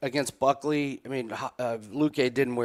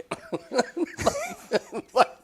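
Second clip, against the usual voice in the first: high, giddy laughing fit; laughter. Real, not acted. Co-host after the winning pick, high giddy laughter.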